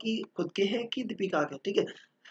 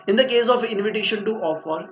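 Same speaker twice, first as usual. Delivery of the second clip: slow, louder dictation pace; 4 semitones higher, 7 dB louder, much slower than usual. Shown as a demonstration. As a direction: read it out word by word for notes. Dictating the definition from the book.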